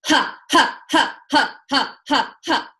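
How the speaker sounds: short, loud, percussive "ha" exhales in quick series; rhythmic "ha" breaths. Shown as a demonstration. Teacher demonstrating Breath of Fire with sound, forceful rhythmic "ha". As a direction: punch each "ha" out from the belly. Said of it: "we're gonna make a sound".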